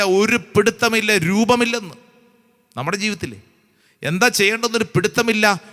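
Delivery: ordinary talk, nothing marked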